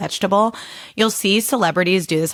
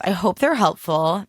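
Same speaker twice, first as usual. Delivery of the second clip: ordinary talk, nothing marked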